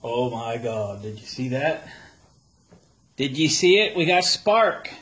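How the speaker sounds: rising excited shout; adrenaline rush. Real, not acted. Engine finally sparks.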